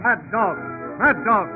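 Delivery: ordinary delivery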